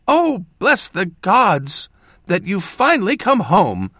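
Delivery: high, slow, overjoyed mother welcoming her son; joyful exclamation. A narrator's reading. Mother welcoming her son home at last, overjoyed. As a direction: burst out with relief and joy. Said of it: "she exclaimed".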